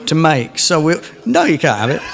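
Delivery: normal talking voice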